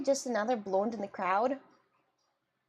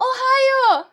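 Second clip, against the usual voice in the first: high, loud delighted shout; excited cry; 12 semitones higher, 11 dB louder than usual. Real, not acted. Streamer spotting a viewer from Ohio, excited shout. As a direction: belt it out in sudden excitement.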